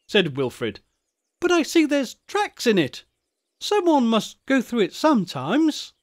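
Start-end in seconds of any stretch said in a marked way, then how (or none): 1.4-5.9 put-on very high-pitched little boy voice; schoolboy character, inquisitive. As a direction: pipe up like an observant schoolboy. Wilfrid's dialogue.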